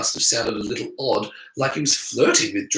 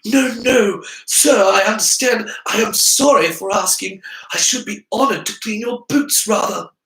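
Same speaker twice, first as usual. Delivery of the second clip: higher, louder, abject apology; bow and scrape aloud; Harry playing the humble servant, raised voice; humble grovelling; 9 semitones higher, 4 dB louder than usual. A narrator's reading. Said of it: "Harry bowed his head".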